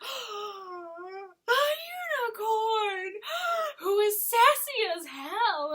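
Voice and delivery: high, loud gasping imitation of amazement; gasp, then exclaim it big and drawn out; mock awestruck excitement. Imitating Odette seeing a unicorn run through town, mock awestruck.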